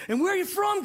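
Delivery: high, peppy host question; mock perky emcee; microphone host. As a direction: imitate an upbeat crowd host. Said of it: "a lady comes out with a microphone".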